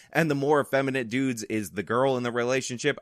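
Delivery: normal talking voice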